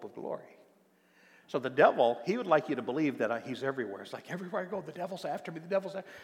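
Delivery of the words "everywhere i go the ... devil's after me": higher, hurried imitation of a hounded believer; mock fearful. Believer convinced the devil follows everywhere, mock fearful. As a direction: pitch it up like someone feeling chased. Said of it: "always running and always afraid".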